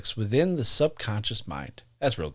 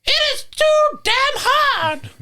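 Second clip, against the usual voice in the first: very high, slow, squeaky spirit voice; high cartoon complaint, louder; Jeff's voice; 5 dB louder, slower than usual. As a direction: squeak it out like a whiny little ghost. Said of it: "complained Jeff at first".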